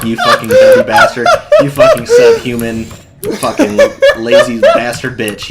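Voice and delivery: half-shouted insults cracking up with laughter; laughing mock yell. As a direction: yell the insults while laughing through them. Imagined rant, laughing and shouting.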